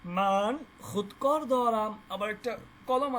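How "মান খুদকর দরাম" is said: মান খুদকর দরাম stretched very slowly, খুদকর stressed; slow example reading. Shown as a demonstration. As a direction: dictate খুদকর slowly for students. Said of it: "খুদকর মানে কলম". (খুব ধীরে, খুদকর-এ জোর)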